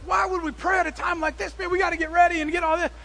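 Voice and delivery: very high, fast exasperated arguing; mock-panicked counselor objecting; frantic protest. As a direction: push back in a rush, no time to pray. Arguing against praying in panic.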